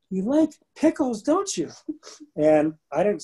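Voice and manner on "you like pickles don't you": squeaky, much higher little voice. Retold or imitated. Man retelling meeting his wife, mimicking her tiny high voice. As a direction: imitate her tiny, high-pitched teasing question. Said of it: "I hear this little voice ... the voice goes".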